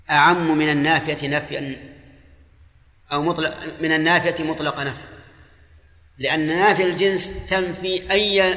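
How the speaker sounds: ordinary talk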